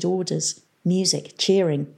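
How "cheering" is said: ordinary delivery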